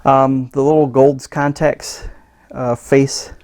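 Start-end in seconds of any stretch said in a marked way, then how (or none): none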